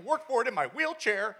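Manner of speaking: put-on high-pitched teen protest; mock indignant. Son in wheelchair guilt-tripping dad, mock indignant. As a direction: play up wounded disbelief for laughs.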